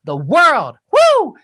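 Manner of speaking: high, slow big welcome; announcer voice. Acted. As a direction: welcome the world like a showman. Host welcomes listeners.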